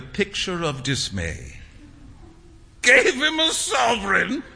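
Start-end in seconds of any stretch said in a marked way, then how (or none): 2.8-4.4 high incredulous shout. A narrator's reading. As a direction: shout it back in disbelief. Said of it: "shouted Trevor".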